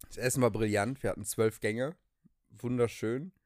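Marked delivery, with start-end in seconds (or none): none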